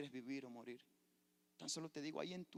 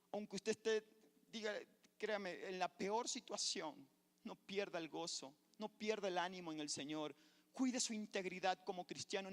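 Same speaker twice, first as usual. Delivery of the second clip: rising sermon; 6 semitones higher, 6 dB louder than usual. Acted. Pastor urging believers not to lose heart, building sermon. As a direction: urge the church to keep their joy.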